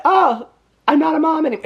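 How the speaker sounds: high-pitched, mildly hammed-up whine; mock lament. Mom told she lost parental rights, mock-mournful high whine. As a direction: imitate a wounded mother, voice pitched high.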